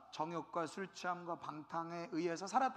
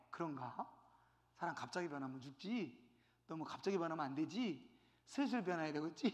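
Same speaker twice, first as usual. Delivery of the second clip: meek giving in; 5 semitones lower, 6 dB quieter than usual. Retold or imitated. Believer meekly giving in.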